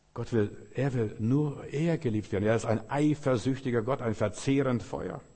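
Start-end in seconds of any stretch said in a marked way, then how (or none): none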